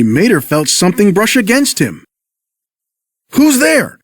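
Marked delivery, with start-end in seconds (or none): 3.3-4.0 higher, frightened question; startled gasp. Acted. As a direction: spin round and gasp it. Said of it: "he gasped".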